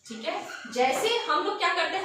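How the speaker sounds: normal talking voice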